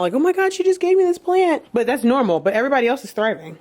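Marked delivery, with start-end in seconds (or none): 0.1-1.6 higher, hammed-up alarm over the plant; a touch of fake freak-out; mock panic. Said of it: "'Cause I was freaking out".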